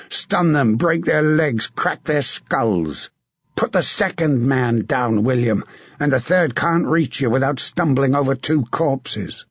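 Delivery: a battle-hardened knight urging a comrade on; hard, rough commander's voice; grim veteran knight giving orders; gruff knight. Acted. Sir John rallies William before battle.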